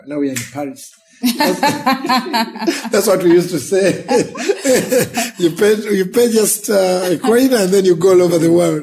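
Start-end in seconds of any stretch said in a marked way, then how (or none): 1.2-8.8 laughing through the words, higher and louder. Real, not acted. Laughing at childhood movie saying.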